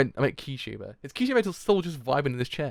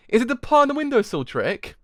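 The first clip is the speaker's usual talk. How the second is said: loud, high, incredulous question; astonished question; 12 semitones higher, 7 dB louder than usual. Real, not acted. Reader spots the trick in the manga, astonished question. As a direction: ask it in rising disbelief.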